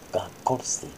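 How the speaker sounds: put-on whispered to a sleepy child. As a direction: hush them off to sleep. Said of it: "unvoiced whisper".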